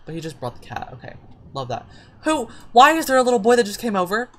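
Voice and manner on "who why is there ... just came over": high, loud bewilderment; baffled outcry. Real, not acted. Player sees a boy turn up, baffled and loud. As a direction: demand an explanation in disbelief.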